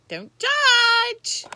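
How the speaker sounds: high loud don't judge; loud playful call. Real, not acted. Calls out to viewers.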